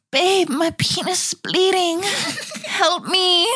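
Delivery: high-pitched, whiny mock-distressed man; hammed-up helpless wail for help; panicking boyfriend. Acting men having periods, panicking.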